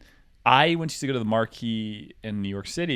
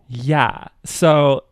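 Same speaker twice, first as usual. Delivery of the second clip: stretching every word out slowly and a bit louder; slowed-down condescending drawl; 4 semitones higher, 9 dB louder, much slower than usual. Retold or imitated. Mimicking a rich girl ordering, slow and patronizing. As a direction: spell it out slowly like to a child. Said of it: "starts slowing it down".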